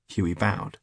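ordinary delivery

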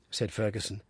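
plain reading voice

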